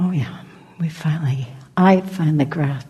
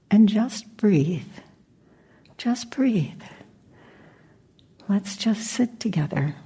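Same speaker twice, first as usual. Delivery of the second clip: guided meditation. Acted. Teacher inviting the group to just breathe, guided meditation.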